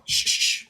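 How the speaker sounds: short unvoiced shushes in a row; repeated shushing. Real, not acted. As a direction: shush someone who keeps interrupting. Hushing the opponent.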